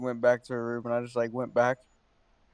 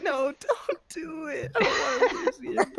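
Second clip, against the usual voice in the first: imitating crying; slower than usual. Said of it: "breaking down and crying, and he was like".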